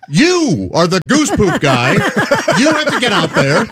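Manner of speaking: high-pitched, exasperated mock telling-off; indignant scolding. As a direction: lay into the culprit, stretching out the accusation. Angrily confronting a coworker in retelling.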